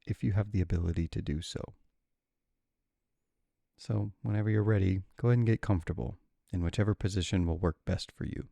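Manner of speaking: calm, unhurried guide with long pauses; guided meditation. Real, not acted. Meditation guide settling listeners with adrenal insufficiency, calm and unhurried. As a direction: ease them gently into stillness, leaving room between phrases.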